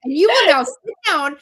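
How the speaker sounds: hammed-up bossy classroom command; mock-stern command. Teacher ordering kids to sit for the song, mock-stern.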